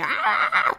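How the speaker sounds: high-pitched thrilled squeal, imitating the ex; mock squeal of excitement; excited squeal. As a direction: squeal like someone thrilled by a text. Ex reacting keenly to a sext.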